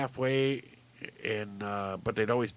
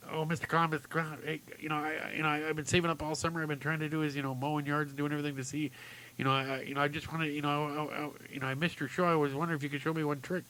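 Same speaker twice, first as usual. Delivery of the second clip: put-on higher, rushed, stammering kid; pleading little boy; 4 semitones higher, 6 dB quieter, faster than usual. Boy begs clown to show one trick, rushed and pleading. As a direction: tumble over the words in a timid plea.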